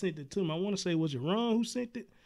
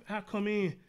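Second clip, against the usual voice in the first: normal talking voice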